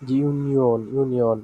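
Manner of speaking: "ইউনিয়ন" stretched as i-u-ni-on; demonstrated pronunciation. Shown as a demonstration. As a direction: model "ইউনিয়ন" carefully with a falling ending. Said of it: "আমরা উচ্চারণ করে ফেলবো".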